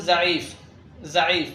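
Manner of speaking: ضعيف with ض said as z, "za'eef"; Urdu pronunciation. Shown as a demonstration. Teacher shows Urdu ضاد to learners, ضعيف with z. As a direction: begin ضعيف on a plain z. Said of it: "ضاد أيضًا ينطق مثل زي".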